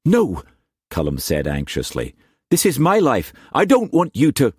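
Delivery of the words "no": anxious no. Acted. Cullum said anxiously.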